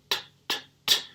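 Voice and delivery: unvoiced "T, T, T" with no vibration; breathy voiceless "T" sound. Shown as a demonstration. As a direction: tap out "T" as a voiceless puff. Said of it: "Põe a mão aqui".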